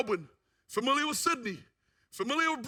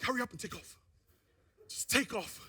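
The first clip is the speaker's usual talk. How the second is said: nervous under breath; 8 dB quieter than usual. Retold or imitated. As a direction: mutter it tensely to the plane, quiet. Nervous on plane, urging.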